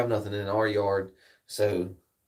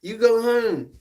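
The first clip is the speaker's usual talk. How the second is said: high, slowish mock telling-off; mock stern yell; 13 semitones higher, 6 dB louder, slower than usual. Reenacting standing his ground against the neighbor's dog, high mock yell. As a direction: plant your feet and order the dog home. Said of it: "I'm, I'm like".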